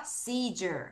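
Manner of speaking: "seizure" modelled softly as SEE-jer, low and falling; teacher's quiet model of "seizure" as SEE-jer; pronunciation demo. Shown as a demonstration. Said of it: "따라하세요".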